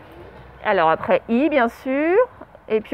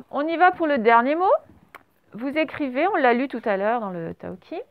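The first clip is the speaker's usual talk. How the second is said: ordinary delivery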